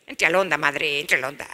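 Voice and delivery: put-on low, lazy street-kid voice; slangy teen. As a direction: talk down to mom, cool and bored.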